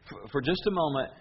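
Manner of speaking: normal talking voice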